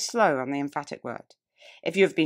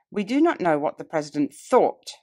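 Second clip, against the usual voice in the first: "thought" punched out louder than the rest; stress on thought; 5 dB louder than usual. Shown as a demonstration. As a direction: lean hard on the word "thought". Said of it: "The words thought and did immediately catch".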